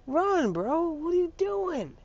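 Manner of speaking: very high, strained plea without extra volume; mock incredulous urging. Viewer to a man just standing there, incredulous urging. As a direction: urge him on in high-pitched disbelief.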